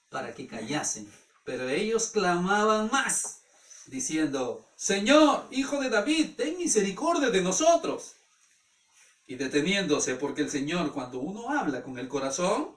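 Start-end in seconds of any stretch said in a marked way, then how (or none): 4.8-8.1 high, raised begging call; pleading cry. Retold or imitated. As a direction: cry out for mercy over the crowd. Blind men cry out louder.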